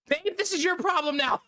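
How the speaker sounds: a hammed-up squeaky panic; mock panicked handoff. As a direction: toss it to the wife and run. Handing problem to wife, joking.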